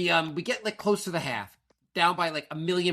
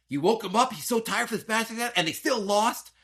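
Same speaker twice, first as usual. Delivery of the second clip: higher-pitched imagined telling-off; mock scolding; 8 semitones higher than usual. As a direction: imitate a wife reproaching you.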